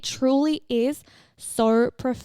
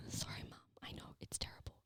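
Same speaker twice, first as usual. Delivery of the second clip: soft unvoiced whisper; whispered apology; 24 dB quieter, faster than usual. Real, not acted. Apologising to Mum for being late, in a whisper. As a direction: whisper sheepishly, as if Mum can hear.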